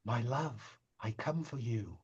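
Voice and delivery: hushed, tender call; soft tinkling voice. Acted. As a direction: murmur it softly, lovingly.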